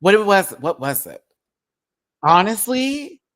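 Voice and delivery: loud, high exclamation; exasperated outburst. Real, not acted. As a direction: burst out in disbelief.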